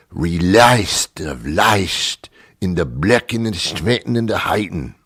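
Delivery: garbled British stage gibberish, partly whispered; overdone British Shakespearean mumbo-jumbo; mock British accent. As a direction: mangle the verse like a hammy British thespian. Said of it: "They have this British accent".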